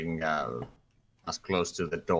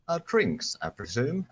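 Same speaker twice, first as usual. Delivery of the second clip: character voice; 7 semitones higher than usual. Acted. Varric speaking in character.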